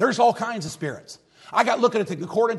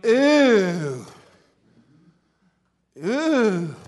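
mock disgust; 4 dB louder than usual. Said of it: "And people come in and go".

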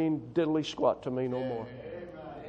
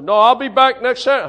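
louder, higher, brash student comeback; defiant shout; 6 semitones higher, 13 dB louder, faster than usual. Retold or imitated. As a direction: blurt it out, sure of himself.

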